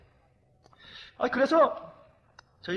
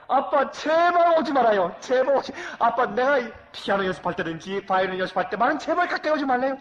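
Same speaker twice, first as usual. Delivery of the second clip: 높고 크게 애원하는 딸; 제발 오지 말라고 매달리듯; pleading daughter; 5 semitones higher, 10 dB louder than usual. Retold or imitated. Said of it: "저 아이가 있으래요". (연습 중 아빠에게 애원하는 딸, 높고 큰 목소리)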